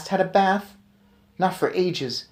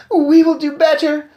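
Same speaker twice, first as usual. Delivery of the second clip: murmuring witches; 13 semitones higher, 9 dB louder than usual. Acted. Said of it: "murmured the audience".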